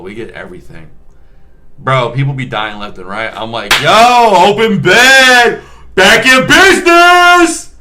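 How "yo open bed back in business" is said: much higher, loud, slow sing-song hype; mock announcer call. Nurse joking at a packed trauma hospital, mock hype call. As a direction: call it out like a game-show host. Joking about beds freeing up.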